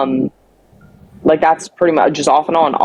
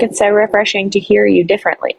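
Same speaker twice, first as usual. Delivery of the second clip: higher-pitched mock gushing; imitating Sam; 6 semitones higher, faster than usual. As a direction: put on someone else's higher voice. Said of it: "I'm not pulling a Sam right now and being like".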